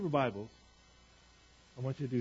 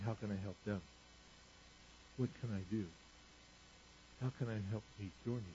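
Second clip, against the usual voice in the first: soft inner voice; 4 semitones lower, 14 dB quieter than usual. Retold or imitated. Soft quoted selfless thought.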